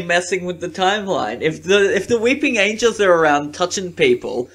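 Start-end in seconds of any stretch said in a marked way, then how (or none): 1.9-4.5 exasperated rant. Real, not acted.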